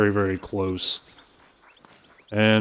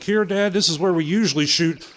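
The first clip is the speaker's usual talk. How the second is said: put-on higher kid's voice calling out; lightly voiced young kid; 8 semitones higher than usual. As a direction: play the boy, pitched up. Kid calling back to dad.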